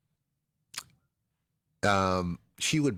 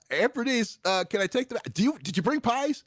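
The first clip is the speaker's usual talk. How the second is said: put-on eager kid; 11 semitones higher than usual. Kid voiced asking aunt.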